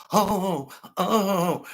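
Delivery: hammed-up fake distress; mock whining. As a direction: imitate an emotional whiner, drawn-out oh. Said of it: "all the Democrats are emotional".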